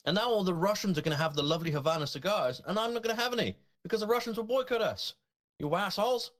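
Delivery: high-pitched, aggrieved ranting; indignant grievance. Real, not acted. Podcaster angry about losing Havana cigars to the Russians, indignant. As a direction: complain as if personally robbed. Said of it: "I may be unnecessarily angry about this".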